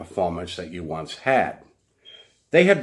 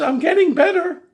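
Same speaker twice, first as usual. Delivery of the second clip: whiny accusation; 8 dB louder than usual. Retold or imitated. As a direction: play her as hurt and childish. Mimicking woman's insistence.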